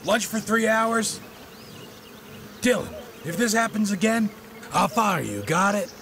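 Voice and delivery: high-pitched angry reprimand; angry scolding. Acted. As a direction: lay down the law to a late employee. Boss scolds late employee.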